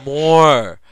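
greedy demand. Retold or imitated. Asking for more lidocaine.

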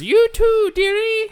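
imitated falsetto crone shriek; loud, piercing mock summons; fortune teller. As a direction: screech it very high, mimicking the old lady. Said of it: "She says".

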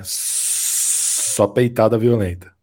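slow, drawn-out funk MC catchphrase; [name]. Retold or imitated. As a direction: drag it out like a funk MC. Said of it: "como diria o M C Luan".

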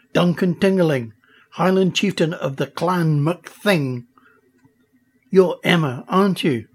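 proud Highland chieftain brogue; Scottish accent. Acted. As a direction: announce yourself like a clan chief. Duncan introduces himself.

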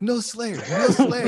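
put-on loud, high French-accented neighbor. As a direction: scold with a thick French accent. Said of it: "she had this heavy, like, French accent".

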